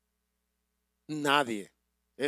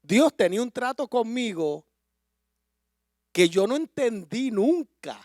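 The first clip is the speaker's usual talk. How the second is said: rising into a loud, high preaching climax; growing into impassioned preaching; 11 semitones higher, 9 dB louder than usual. Real, not acted. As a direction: build the sermon to full force. Preaching at full force, building.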